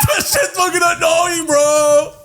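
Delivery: very high, drawn-out exasperated whine; teasing high-pitched protest; mock complaint. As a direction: complain in a high, hammed-up cry. Teasing friend's drunk speeches.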